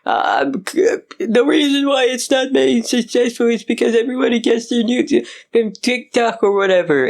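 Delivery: high, fast whiny mockery; mocking whiny voice. Mocking the director's whiny excuse in a high whine. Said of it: "he's saying nonsensical things".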